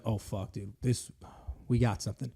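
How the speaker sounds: low, hushed mock dismay; retold alarmed doctor. Doctor retold breaking news of a lump, quietly alarmed. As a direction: drop it low and soft, imitating grim news. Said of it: "like, his face fucking".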